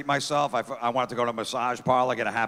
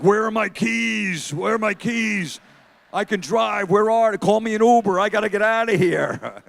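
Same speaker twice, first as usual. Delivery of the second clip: put-on high, drawn-out drunk yelling, building louder; mock drunk; 7 semitones higher, 4 dB louder than usual. Six-year-old faking drunk after a lemonade shot, loud mock drunk. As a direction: play a kid pretending to be hammered. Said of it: "he starts acting drunk".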